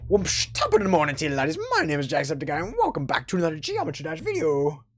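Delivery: put-on chirpy Irish YouTuber greeting, Irish accent; Jacksepticeye impression. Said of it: "I could be".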